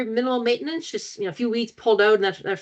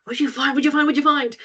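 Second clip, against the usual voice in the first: higher, rapid-fire pestering question; retold eager anticipation; 6 semitones higher than usual. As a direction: re-enact bursting to hear the news. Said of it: "building anticipation for me".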